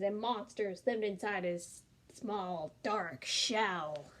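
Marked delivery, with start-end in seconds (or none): none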